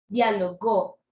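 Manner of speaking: Dialogó with a strong, drawn last syllable; dia-lo-GÓ, weight saved for the end; stress on final GÓ. Shown as a demonstration. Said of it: "Miren la diferencia".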